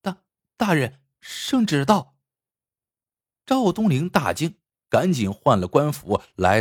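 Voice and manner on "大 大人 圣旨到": stammered, mildly flustered report; flustered announcement. A narrator's reading. Maid reporting to her master from outside the door, mildly flustered. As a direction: stumble on the first word, a touch of panic. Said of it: "慌张地在门外通报".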